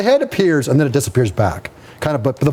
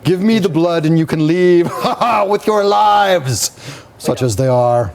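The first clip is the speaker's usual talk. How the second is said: menacing vampire Count; 4 semitones higher than usual. Acted. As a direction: threaten as the Count, laughing mid-line. Game master voices the Count.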